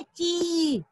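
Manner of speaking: ची with a long, held ee; long vowel. Shown as a demonstration. Teacher showing how they pronounced it, long-held ची. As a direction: draw out the vowel of ची. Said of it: "कैसा उच्चारण करे थे हमने?".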